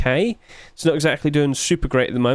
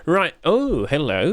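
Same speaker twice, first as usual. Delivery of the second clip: normal talking voice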